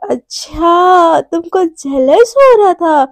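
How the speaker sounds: long drawn-out, delighted "अच्छा"; amused teasing. Acted. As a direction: tease slowly, stretching the words. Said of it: "shock हो जाती है और उसको हंसी भी बहुत आती है".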